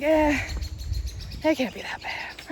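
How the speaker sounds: dismissive murmur. Retold or imitated. Dismissing her own doubts.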